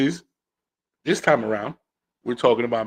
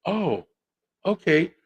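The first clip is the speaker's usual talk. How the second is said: mock resigned; 6 semitones higher, slower than usual. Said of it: "has to curb himself and say".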